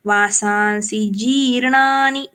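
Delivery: जी in जीर्णानि held long; long vowel stress. Shown as a demonstration. Teacher shows the long vowel जी in जीर्णानि. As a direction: stretch the जी of जीर्णानि. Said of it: "जी दीर्घ है".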